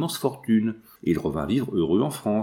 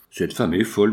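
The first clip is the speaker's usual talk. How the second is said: usual speaking voice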